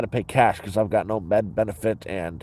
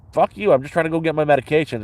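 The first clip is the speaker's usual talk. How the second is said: higher, fast, heated snap back; angry retort; 6 semitones higher, 3 dB louder, faster than usual. Retold or imitated. Retelling the guard clash, a fast angry retort.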